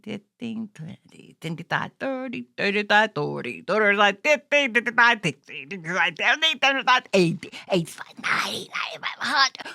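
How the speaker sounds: ordinary talk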